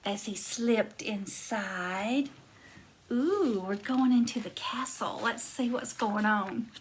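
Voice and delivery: quiet as if creeping in; hushed. A narrator's reading. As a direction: lean in and hush it. Sneaking into the castle.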